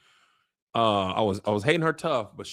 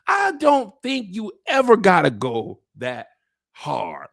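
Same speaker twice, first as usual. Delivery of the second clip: ordinary delivery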